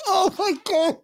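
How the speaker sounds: high, shrill squeal of glee; squealing delight. Real, not acted. Fan squealing as rivals lose, in glee. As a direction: shriek it like you can't believe your luck.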